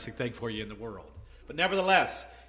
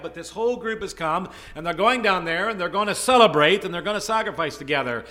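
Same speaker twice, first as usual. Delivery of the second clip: loud, rising sermon build; preaching; 5 semitones higher, 10 dB louder than usual. Acted. Preacher rousing the congregation, loud and building.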